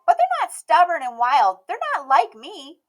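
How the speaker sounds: put-on high, bright little girl voice, loud and proud; a stubborn little girl declaring herself; Emmy's child voice. A narrator's reading. Emmy speaking.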